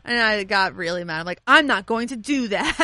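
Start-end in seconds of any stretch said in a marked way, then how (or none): 1.4-2.8 firm, louder refusal; stern, louder no. Retold or imitated. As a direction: shut her down sternly. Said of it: "I said it really sternly".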